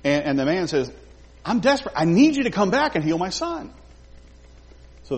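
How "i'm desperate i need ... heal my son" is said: a pleading father, pitched higher; desperate plea. Retold or imitated. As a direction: beg for your son's life. Father begs Jesus to come.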